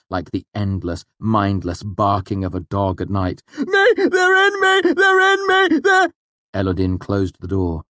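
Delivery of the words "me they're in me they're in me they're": very high, loud, frantic shrieking; panicked screaming. Acted. A restrained asylum patient screams in panic. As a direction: scream it over and over in terror. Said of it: "The screaming continued in an unbroken chant".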